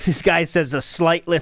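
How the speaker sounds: ordinary talk, nothing marked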